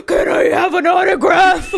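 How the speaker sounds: high, loud autograph plea; a big dude begging like a giddy kid; mock fan shouting for an autograph; eager fan shout. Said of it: "running up on you and be like".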